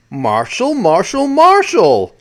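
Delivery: rising pitch and volume on each repeat; hammed-up sitcom catchphrase, last name high and drawn out; mock exasperated whine. Mimics a Brady Bunch catchphrase.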